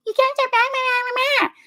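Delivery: high, slow, scolding whine; mock nag about the laundry; nagging wife. Shown as a demonstration. Wife nagging husband over laundry, high scolding whine. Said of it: "you can't be like".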